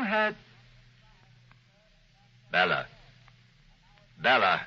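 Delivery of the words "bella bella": stern call. Acted. Husband calls her sternly from afar.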